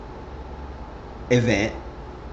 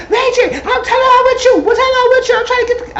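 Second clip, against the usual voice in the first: very high, louder laughing mock voice; mock mom; 5 dB louder than usual. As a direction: squeal at him like a pushy mom. Voicing mom at Ray J.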